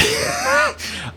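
high breathy burst of laughter; sudden high wheezing laugh. Real, not acted. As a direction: crack up mid-story. Amused by his own story.

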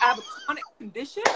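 incredulous, high shriek with a laugh breaking through; stunned disbelief. Retold or imitated. Retelling hearing her diagnosis to host, shrieking in laughing disbelief.

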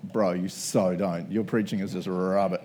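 low, slow mock put-down; mock critic. Congregation member heckling the preacher, low mock put-down. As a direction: drop into a dry heckling voice. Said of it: "come up afterwards and be like".

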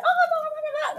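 high squeaky mock refusal; mock squeal. Mocking people who want drama, squeaky high no.

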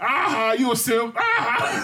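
high, cackling gotcha tease; teasing a friend who caved to his partner, high and gleeful. Retold or imitated. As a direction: gloat at him for finally giving in. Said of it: "And I said".

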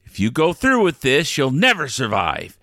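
ordinary talk

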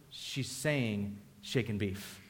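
quiet and slow, leaning in to explain; hushed explaining. Retold or imitated. Wife quietly explains the order to her clueless husband, hushed and slow. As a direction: murmur it slowly so only he hears. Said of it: "She goes".

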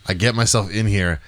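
ordinary talk, nothing marked

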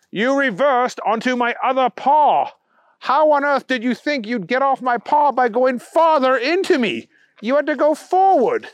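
high, emphatic scolding; exasperated bunny. A narrator's reading. Bunny berating Booger for reversing onto him, exasperated scolding.

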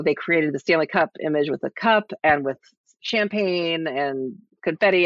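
ordinary talk, nothing marked

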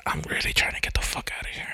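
whispered, fast and hushed; whispered aside. Retold or imitated. Superman whispering his getaway plan, hushed and quick. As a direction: whisper it like a secret escape plan. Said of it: "And he's like".

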